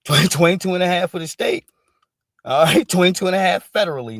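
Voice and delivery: gleeful mock judge, laughing; laughing mock sentencing. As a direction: laugh while reading out the years. Mocking judge reading out sentence.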